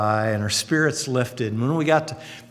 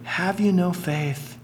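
gentle rebuke; 6 semitones higher than usual. Retold or imitated. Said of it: "he says to disciples".

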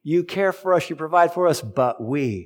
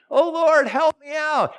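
mock desperate plea; slower than usual. Imitating believer crying for help.

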